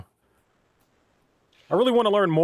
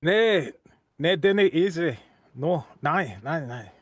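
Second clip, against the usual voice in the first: put-on Scots refusal, fading away; Scottish accent; slower than usual. Answering that it is not easy, in a Scottish accent. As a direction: disagree in a mock Scottish brogue. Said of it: "nail the accent".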